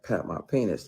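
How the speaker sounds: ordinary talk, nothing marked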